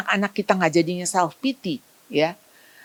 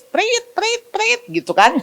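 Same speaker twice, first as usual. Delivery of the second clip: whistle blasts; 12 semitones higher than usual. Retold or imitated. Imitating a drill whistle.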